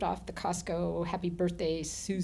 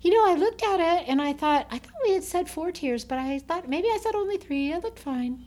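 light bride voice; 10 semitones higher, 6 dB louder than usual. Retold or imitated. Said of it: "And she goes".

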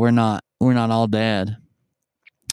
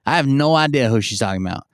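usual speaking voice

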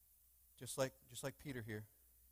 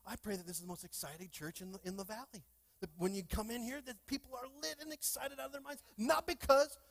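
high, climbing, thrilled call; excited preaching; 10 semitones higher, 7 dB louder than usual. Acted. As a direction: lift them up with joy.

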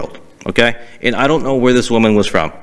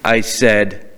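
usual speaking voice

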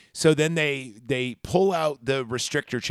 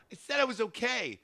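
higher, softer brush-off; lightly voiced teen; annoyed teen; 9 semitones higher, 8 dB quieter than usual. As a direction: brush it off like a kid. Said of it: "And she goes".

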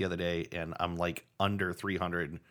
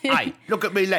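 pirate-ish Irish voice, louder and higher; put-on Irish accent; 9 semitones higher, 7 dB louder than usual. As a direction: lay the Irish on thick. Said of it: "this is the accent episode".

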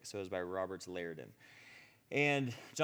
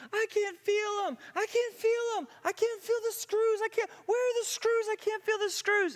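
very high, loud, frantic wail; weeping in amazement; 8 dB louder than usual. Retold or imitated. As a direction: imitate a friend shrieking through tears at her healing. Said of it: "she's weeping".

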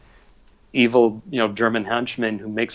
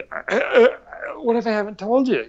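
flustered grandson; 11 semitones higher than usual. Retold or imitated. Grandson answering grandpa on the phone, imitated high flustered voice. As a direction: act out being caught off guard. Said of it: "And I'd be".

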